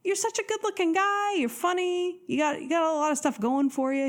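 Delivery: mothering the date from above, gently; mama bear. Retold or imitated. Consoling a date who blew it, in a mama-bear coo. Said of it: "like a mama bear to him".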